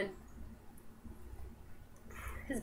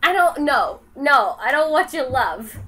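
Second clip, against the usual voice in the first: pushing the dog away; 2 semitones higher, 9 dB louder than usual. Real, not acted.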